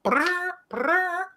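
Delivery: very high squealing creature cry; creature screech. Retold or imitated. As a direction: squeal like a tiny alien beast. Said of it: "in the trailer goes".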